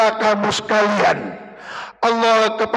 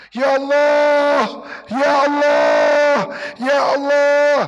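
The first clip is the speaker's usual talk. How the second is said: louder, higher slow wailing ya Allah; crying plea; 5 semitones higher, 3 dB louder, slower than usual. Pretend. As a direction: cry out to God in tears. Acted weeping prayer to God. (meratap sambil menangis)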